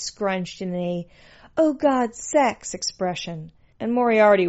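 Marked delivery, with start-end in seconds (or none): 1.6-2.7 higher-pitched mimicked sex moan; breathy-free, high-pitched mock rapture; mock ecstasy. A narrator's reading. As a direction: mimic an overwrought lover's cry. Narrator mimics her ecstatic expression.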